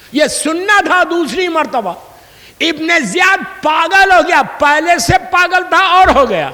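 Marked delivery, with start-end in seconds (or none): none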